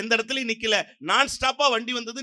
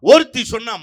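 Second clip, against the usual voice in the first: usual speaking voice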